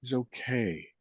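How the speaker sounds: soothing self-talk. Shown as a demonstration. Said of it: "then it can lead into".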